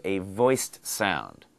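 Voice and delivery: voiced stretched out slowly; slow emphasis. Shown as a demonstration. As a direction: lean slowly on the word voiced. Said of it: "look at the same sound".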